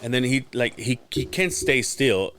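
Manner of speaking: ordinary talk, nothing marked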